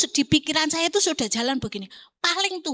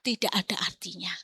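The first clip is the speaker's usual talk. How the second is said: bisikan; 6 semitones lower, 5 dB quieter than usual. Real, not acted. Khotbah, penekanan lirih.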